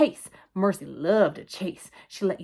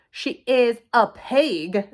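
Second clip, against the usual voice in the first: loud, very slow, higher-pitched; insistent protest; 4 semitones higher, 7 dB louder, much slower than usual. A narrator's reading. Eugenia contradicts the Watsons before crying, insistent. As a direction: insist on each word, stretched out. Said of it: "She started to cry".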